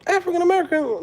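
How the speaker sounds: very high-pitched disbelief; mock bewilderment. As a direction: ask it way up high, baffled. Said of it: "and you're like".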